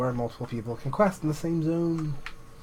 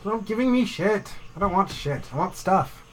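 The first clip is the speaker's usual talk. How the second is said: exasperated; 7 semitones higher than usual. Real, not acted. Annoyed at loot drops.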